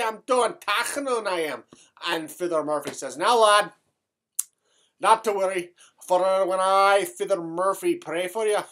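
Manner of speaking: thick stage Irish brogue throughout. Acted. Comic Irish character telling a tale to the audience in a brogue. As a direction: keep the comic Irish lilt going.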